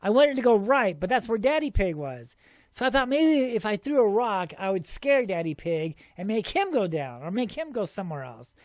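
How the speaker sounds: ordinary delivery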